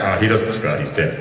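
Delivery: low voice playing the unbudging writer; deadpan bearer of news. Writer's replies in retold dialogue.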